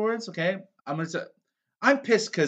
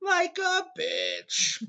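much higher and very slow, drawn-out; stretched high repeat; 13 semitones higher, much slower than usual. Acted. Repeating the joke to his friend, high and drawn-out. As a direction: stretch the punchline way up.

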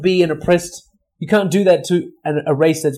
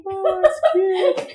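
laughing; much slower than usual. Real, not acted. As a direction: crack up laughing. Both laughing hard.

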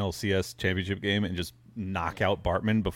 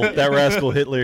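laughing; 6 semitones higher, 5 dB louder, slower than usual. Pretend. Laughing riff on the bit.

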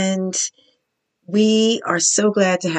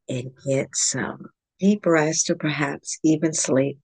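calm, measured meditation guide with long pauses; guided yoga nidra. Real, not acted. Yoga teacher guiding listeners into yogic sleep, calm meditation voice.

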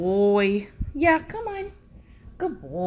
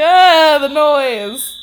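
louder, higher whiny dog complaint; upset dog voice; 5 semitones higher, 12 dB louder, much slower than usual. Retold or imitated. Dog complaining about the door alarm noise, loud whine. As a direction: play the dog annoyed at the alarm. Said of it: "Ranger's just like".

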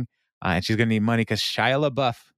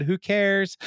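mock dismissive; 11 semitones higher than usual. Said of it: "And they said".

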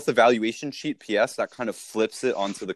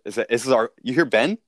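normal talking voice

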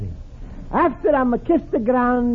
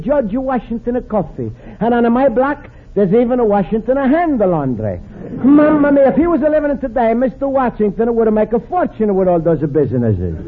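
normal talking voice

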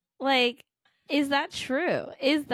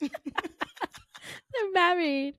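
"married" stretched very slowly and higher, Jersey style; mimicked accent; 6 semitones higher, much slower than usual. Friend teasing co-host about accent, exaggerated Jersey vowels. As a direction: drag out a mock Jersey "married".

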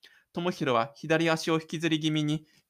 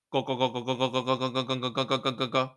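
fast low rumbling go-go-go; engine rumble; 3 semitones lower, much faster than usual. A narrator's reading. Narrator voicing the school bus engine, fast rumble.

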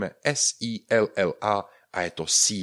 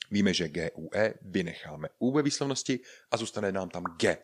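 usual speaking voice

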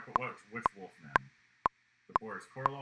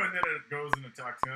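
ordinary delivery

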